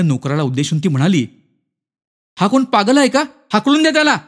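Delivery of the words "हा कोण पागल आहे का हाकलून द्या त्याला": high, alarmed call to a servant; rising, panicky high voice; frightened woman. A narrator's reading. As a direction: order the intruder out in fright. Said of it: "आणि ती घाबरली".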